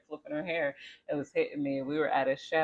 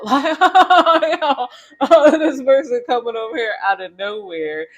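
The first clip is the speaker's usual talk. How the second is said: louder, higher, words through laughter; laughing; 9 semitones higher, 14 dB louder than usual. Real, not acted. Recounting the surprise visitor while cracking up. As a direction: laugh as you tell it.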